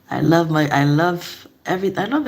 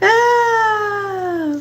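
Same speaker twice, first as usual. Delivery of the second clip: high laughing cry. Real, not acted. Overcome reacting to chat.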